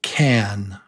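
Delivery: can with a clipped "a"; short quick A. Shown as a demonstration. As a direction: say can short for a listening test. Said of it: "listen to this one".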